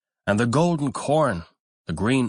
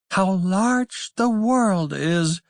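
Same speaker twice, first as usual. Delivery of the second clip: high, slow, wide-eyed wonder; young ducks marvelling; 10 semitones higher, slower than usual. A narrator's reading. Ducklings marvel at the big world, high and slow. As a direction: sing it out in awe like a duckling. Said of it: "said the young ducks".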